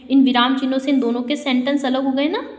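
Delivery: normal talking voice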